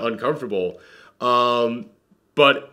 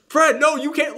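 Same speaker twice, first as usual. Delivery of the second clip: much higher, pleading voice; desperate plea; 12 semitones higher than usual. Retold or imitated. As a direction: beg him not to give up. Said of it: "she has this tone to it".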